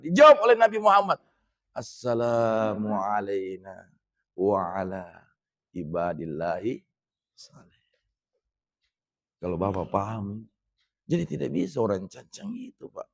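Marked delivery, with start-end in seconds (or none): 1.7-7.8 slow, softer recitation fading into a whisper. Shown as a demonstration. Preacher recites the Prophet's reply, softly fading to a whisper. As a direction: let the prayer sink to a hush.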